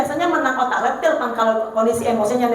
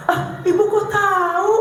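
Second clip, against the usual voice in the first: put-on surprised child; 7 semitones higher than usual. Surprised child answering counselor.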